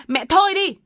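high, loud barked command; angry shout. Acted. Son shouting down his mother, furious. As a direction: yell at her to stop. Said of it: "Việt quát lớn".

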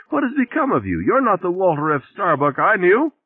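very high, lamenting bag-lady voice; wailing reproach. A narrator's reading. Shopping bag lady laments what became of Walter, wailing. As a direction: lament at a high pitch to the end.

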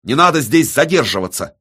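high, loud, strained outburst; cracking-voice shout. A narrator's reading. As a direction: cry out as your nerves give way. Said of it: "срывающимся голосом прокричал Виссарион".

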